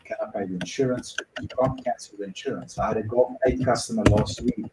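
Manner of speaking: usual speaking voice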